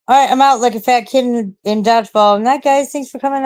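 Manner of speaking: upbeat, loud sign-off; loud goodbye. Real, not acted. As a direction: wrap it up with gusto.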